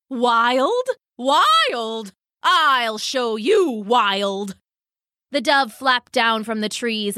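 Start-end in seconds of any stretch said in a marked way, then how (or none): 0.1-0.9 questioning "Wild?" rising at the end; incredulous dove. Acted. Dove repeats word, incredulous. 1.2-2.1 "Wild" drawn out high, then falling; outraged dove. Acted. 2.4-4.6 slow, emphatic affronted threat; threatening dove. Acted. Dove threatens after being provoked.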